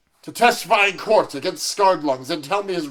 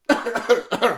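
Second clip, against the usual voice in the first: fake cough; 4 semitones higher than usual. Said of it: "I don't know why I'm coughing so much".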